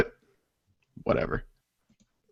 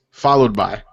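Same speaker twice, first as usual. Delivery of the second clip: usual speaking voice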